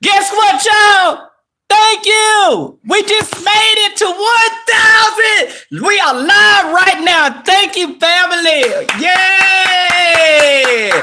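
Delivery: high, loud, joyful shouting at the milestone; excited celebration. Real, not acted. Streamer celebrating hitting a thousand subscribers with fans, shouting for joy. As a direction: yell your thanks, over the moon. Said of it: "I'm excited".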